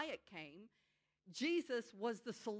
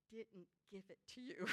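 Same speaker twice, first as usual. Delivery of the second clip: gentle reassurance; 3 semitones lower, 9 dB quieter, faster than usual. Retold or imitated. Chaplain's gentle reply.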